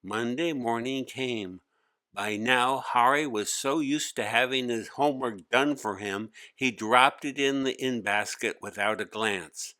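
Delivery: usual speaking voice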